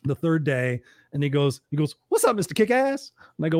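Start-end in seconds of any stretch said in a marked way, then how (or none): 2.1-3.1 high-pitched hearty greeting; Les Brown. Retold or imitated. Les walks in on the third day, high-pitched greeting. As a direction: hail him in a raised, playful voice. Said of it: "So Les comes walking in".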